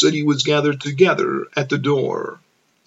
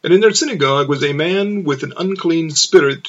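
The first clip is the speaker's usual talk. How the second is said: plain reading voice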